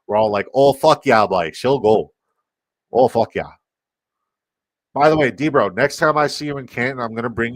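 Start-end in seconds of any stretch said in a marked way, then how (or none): 0.5-3.5 rough small-town Canadian voice; louder Canadian Letterkenny impression. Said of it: "all of us sound like Letterkenny".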